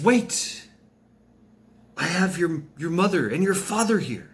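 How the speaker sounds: goddess's high, insistent bid; urgent call to stop. Acted. Goddess urgently stops Bruce leaving.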